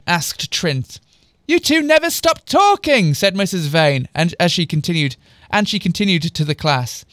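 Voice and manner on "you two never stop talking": high, loud telling-off; exasperated scolding. A narrator's reading. Teacher explaining call to parents, loud exasperated scolding. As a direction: scold them, pitched right up.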